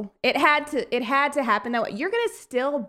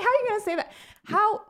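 indignant disbelief; 10 semitones higher, much faster than usual. Retold or imitated. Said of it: "I was like".

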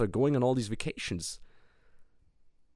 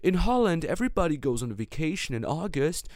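higher, breezy mock client; a touch of a smug holidaymaker; mimicking the client; 6 semitones higher than usual. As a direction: play the carefree client, lightly mocked. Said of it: "And he's like".

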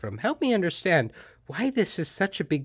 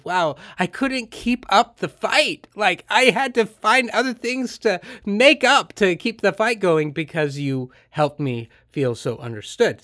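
amazed admission; 7 semitones higher, 7 dB louder than usual. Retold or imitated. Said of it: "And they said".

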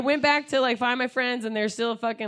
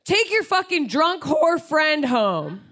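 loud, high, slow mock bark; bouncer; 7 semitones higher, 5 dB louder, slower than usual. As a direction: imitate the bouncer ordering her friends away, word by word. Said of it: "he turns to my friends and he goes".

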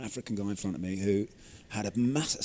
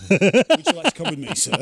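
higher, louder officer line through laughter; laughing mock officer; 7 semitones higher, 13 dB louder than usual. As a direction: play the stern officer, cracking up.

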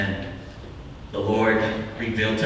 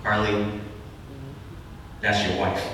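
hushed voice of God; 5 semitones lower, 9 dB quieter than usual. Retold or imitated. Voicing the Lord's quiet word.

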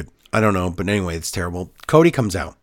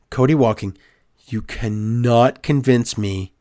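ordinary talk

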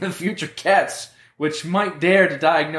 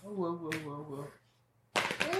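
quiet whoa; 16 dB quieter than usual. Real, not acted. Quiet fading whoas.

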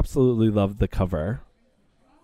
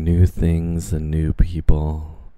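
story narration; 6 semitones lower than usual. Acted. Host narrating their Bergdorf afternoon to listeners, low and dramatic. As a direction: narrate the lunch trip like a film voiceover.

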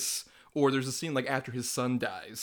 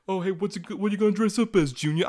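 a hint of a mob dad, higher; playful mobster dad, slightly raised; lightly voiced Gotti; 7 semitones higher, 5 dB louder than usual. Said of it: "he's just like".

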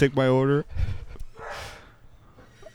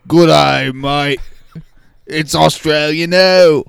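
a loud, slow, hammed-up Australian greeting; Australian accent; 4 dB louder, slower than usual. Called out as the Australian, he greets everyone in a hammed-up Australian accent. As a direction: greet them like a cheery Aussie in a broad Australian accent. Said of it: "Australian, oh, come out here".

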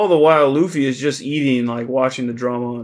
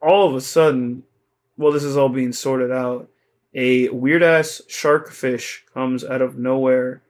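ordinary talk

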